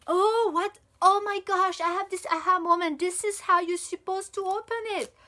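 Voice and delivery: high, loud burst of delighted surprise; astonished realization. Real, not acted. Reviewer discovering how the package opens, astonished realization. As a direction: exclaim it like a sudden aha moment.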